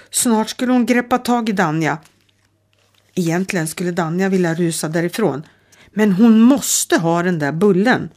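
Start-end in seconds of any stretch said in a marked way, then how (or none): none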